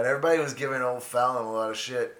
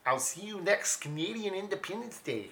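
higher-pitched mock-Canadian farewell; Canadian accent; 7 semitones higher, 4 dB quieter than usual. Comic voicing Trudeau's farewell in a higher Canadian accent. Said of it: "that's a Canadian accent".

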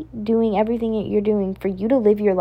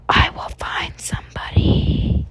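slow eerie whisper. Acted. Third repeat, eerier whisper.

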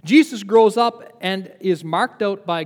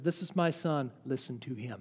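hushed voice of God; 6 semitones lower, 12 dB quieter than usual. Retold or imitated. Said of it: "God's voice speaks from heaven and says".

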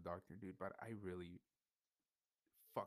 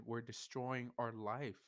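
normal talking voice

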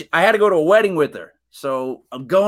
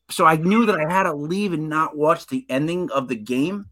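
ordinary delivery

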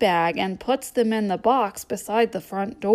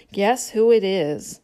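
ordinary delivery

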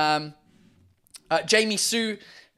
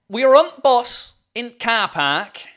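slow, loud attempted Yorkshire; exaggerated Yorkshire dialect; Yorkshire accent; 3 semitones higher, 7 dB louder, slower than usual. Shown as a demonstration. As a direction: lay on broad Yorkshire, dropping "the". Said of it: "try to do a Yorkshire accent".

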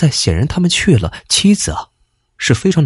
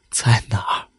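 faint whispered question, softer; weak whisper; 4 semitones lower, 5 dB quieter than usual. A narrator's reading. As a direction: breathe it out, barely able to speak. Injured husband asks weakly.

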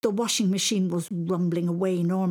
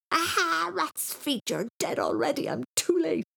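put-on very high horrified boy; high-pitched panicked outburst; screaming in fright; 12 semitones higher than usual. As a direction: wail in dismay. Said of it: "he screamed even louder".

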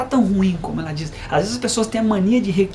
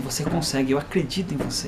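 low, soft fatherly voice; quiet, steady belief in someone; calm encouragement; 3 semitones lower, 4 dB quieter than usual. Retold or imitated. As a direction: tenderly reassure a doubting child. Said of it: "falo assim".

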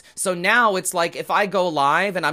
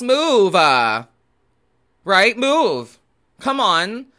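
higher and louder, urging people to get moving; fed-up plea to walk, pitched up; exasperated pleading; 5 semitones higher, 6 dB louder, slower than usual. Real, not acted. Ranting about moving sidewalks.

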